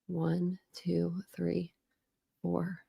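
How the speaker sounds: very soft, half-voiced counting; counting to oneself. Real, not acted. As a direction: count pages under your breath. Counting note pages to herself.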